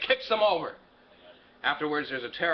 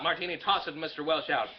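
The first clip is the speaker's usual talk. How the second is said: ordinary delivery, nothing marked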